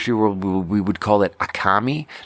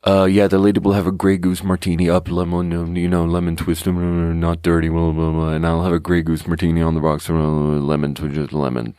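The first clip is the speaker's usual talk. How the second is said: lower, mumbled drink order rattled off; mumbling customer; 5 semitones lower than usual. Retold or imitated. Bartender imitating a customer mumbling his order, low mumble. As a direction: mumble it as if hiding the words. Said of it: "he looks down and he mumbles like".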